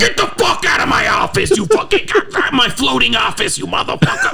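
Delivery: high, shouted sleazy boss; Hollywood Tony voice. Acted. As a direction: yell him out of the office. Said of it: "Hollywood Tony".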